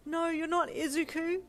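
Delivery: high, loud panicked screech; shrill screeched denial. A narrator's reading. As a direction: screech it in frightened disbelief. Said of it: "you screeched".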